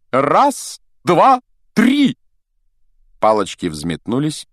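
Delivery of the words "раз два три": high, building shouted count; loud countdown. A narrator's reading. Duel master starting the fight, shouted countdown. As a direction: call the count out, climbing to a cry.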